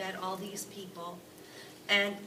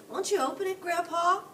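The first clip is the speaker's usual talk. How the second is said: put-on higher, louder pleading boy; eager boy; 10 semitones higher, 8 dB louder than usual. Charlie begging Grandpa Joe to open the bar, eager.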